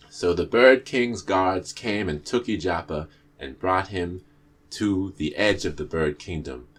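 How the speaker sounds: usual speaking voice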